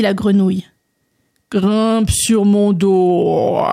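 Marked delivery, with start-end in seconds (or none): 1.5-3.6 slow, croaking frog with drawn-out vowels; frog character. Acted. Frog inviting André onto its back, slow croaky drawl. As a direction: stretch the last word into a long croak.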